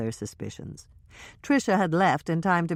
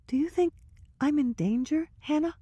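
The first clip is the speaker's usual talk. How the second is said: higher voice with a touch of worry; scared Trisha; 13 semitones higher than usual. Acted. Trisha asking Hannah if she's in danger, mildly scared. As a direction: ask it small and frightened.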